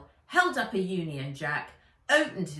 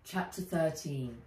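plain reading voice

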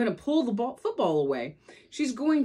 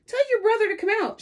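put-on much higher, fast bossy girl voice; bossy Lucy; 13 semitones higher, 4 dB louder, much faster than usual. Lucy demands Sally fetch her brother, high and fast. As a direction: order it out quickly and shrill.

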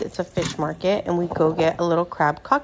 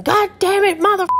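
high-pitched burst of cursing, much higher; imitating dad cursing; 13 semitones higher, 4 dB louder than usual. Dad burned by his cigarette, cursing in a high yelp. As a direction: yelp the curse as if just burned. Dad swearing after burning himself.

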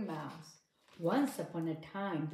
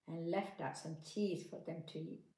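usual speaking voice

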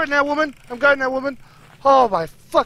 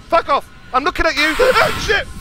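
ordinary talk

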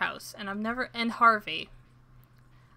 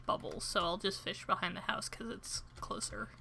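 whisper; 8 dB quieter than usual. Real, not acted. Quiet aside while fishing.

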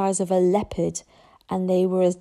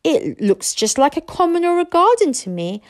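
higher-voiced sceptical chief; doubtful; 9 semitones higher, 4 dB louder than usual. A narrator's reading. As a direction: voice your doubts about the breed. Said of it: "surveyed the Toothless Daydream doubtfully".

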